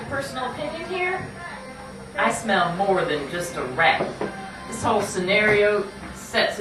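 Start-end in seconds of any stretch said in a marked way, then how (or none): none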